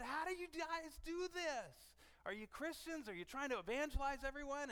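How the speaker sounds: high-pitched excited questioning; eager amazement. Retold or imitated. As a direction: re-enact wide-eyed questions to coworkers. Acting out his eager questions to coworkers.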